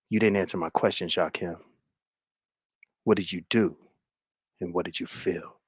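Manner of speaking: low, soft, insistent mock-drama; low soap-opera intensity; hushed questioning. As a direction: press for an answer in a hushed low voice. Acts tense, intimate questioning.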